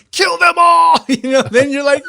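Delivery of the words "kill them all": loud, high battle shout; rally cry. Acted. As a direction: roar it out to fire up your buddy. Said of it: "if you hear your buddy going".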